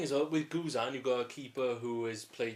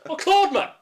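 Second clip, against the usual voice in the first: mock Welsh shout; 18 dB louder than usual.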